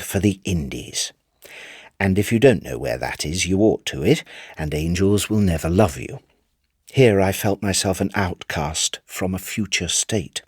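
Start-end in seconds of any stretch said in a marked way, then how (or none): none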